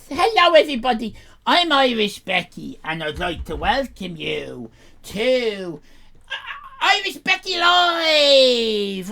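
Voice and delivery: lightly voiced teen character host; Irish Becky. Irish Becky welcoming viewers to her live show. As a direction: announce the show as a brash teen.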